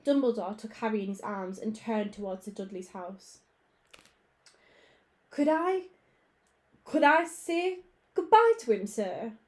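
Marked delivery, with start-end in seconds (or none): 5.3-9.3 high, loud pleading giant; pleading Hagrid. A narrator's reading. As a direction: beg to say goodbye, voice straining. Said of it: "asked Hagrid".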